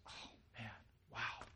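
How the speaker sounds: hushed, stunned murmur; awestruck. Acted. Preacher voicing synagogue people struck by the teaching, awestruck. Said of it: "They're struck by his words".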